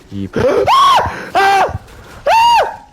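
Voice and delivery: high, loud startled screams; jump-scare shriek; real scream. Real, not acted. Streamer startled by a zombie, shrieking high and loud. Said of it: "désolé, j'ai crié".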